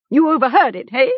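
high, sceptical throwing back; mocking echo. A narrator's reading. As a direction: echo the excuse in disbelief. Captor echoes Gom's excuse, disbelieving.

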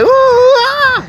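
high, loud yelp of alarm; squeamish yelp. Retold or imitated. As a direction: yelp like the drill just started. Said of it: "you're like".

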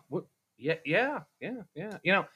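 ordinary talk

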